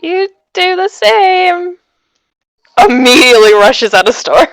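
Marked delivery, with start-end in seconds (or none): 0.0-1.8 higher, louder, drawn-out awkward goodbye; awkward farewell. Acted. Uncomfortable customer to shop clerk before rushing out, awkward. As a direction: stretch out an uneasy, too-loud farewell. Flustered character's parting before fleeing.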